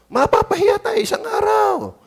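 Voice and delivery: high shouted warning; preacher's sudden high shout. Real, not acted. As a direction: cry it out sharply. Preacher warns congregation.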